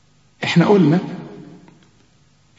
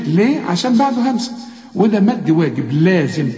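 normal talking voice

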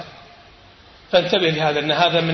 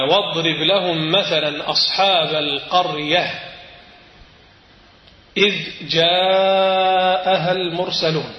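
slow chanted recitation of the verse; Quran recitation; slower than usual. Acted. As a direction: draw out the verse in tajweed style.

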